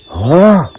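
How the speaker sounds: shocked drawn "oh" gasp; crowd's gasp. A narrator's reading. Crowd startled by the out-of-place stranger, shocked gasp. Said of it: "the crowd gasped in unison".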